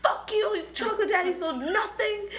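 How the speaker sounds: high-pitched mock angry outburst. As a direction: blow up indignantly, half laughing. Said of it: "And [name]'s like".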